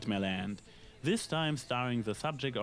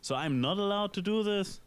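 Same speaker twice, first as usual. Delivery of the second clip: mock indignant; 8 semitones higher than usual. Real, not acted. Defending his own pun.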